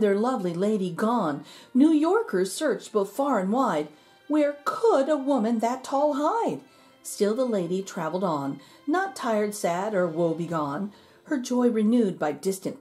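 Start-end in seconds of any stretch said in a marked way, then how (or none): none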